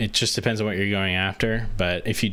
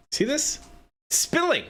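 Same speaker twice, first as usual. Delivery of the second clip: much higher, alarmed exclamation; startled high yelp. Real, not acted. Drink spills while streaming.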